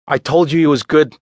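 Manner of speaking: like a proud father presenting a son; boastful. A narrator's reading. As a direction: show off your find to the room. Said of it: "Danny boasted to the room".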